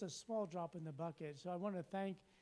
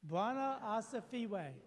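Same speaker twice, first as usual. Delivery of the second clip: louder repeat; 6 semitones higher, 4 dB louder, slower than usual. Shown as a demonstration. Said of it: "Say it again".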